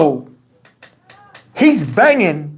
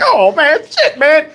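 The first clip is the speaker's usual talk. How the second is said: distressed; 9 semitones higher, slower than usual. Retold or imitated. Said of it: "he kept pulling his hair like".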